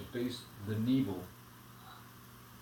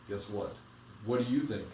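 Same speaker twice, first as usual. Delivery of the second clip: plain reading voice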